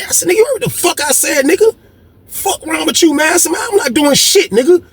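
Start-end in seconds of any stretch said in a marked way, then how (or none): 0.3-3.3 high, confrontational retelling; heated retort. Retold or imitated. Acted-out quarrel at the table. 3.5-4.8 high-pitched acted-out argument; indignant refusal. Acted-out quarrel, refusing a demand.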